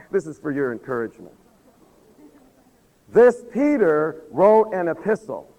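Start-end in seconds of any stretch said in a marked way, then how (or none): 3.1-5.5 loud, high, emphatic proclamation with stretched climaxes; full-force preaching. Real, not acted. Preacher encouraging the congregation about living stones, at full force. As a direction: proclaim it, swelling to a drawn-out shout.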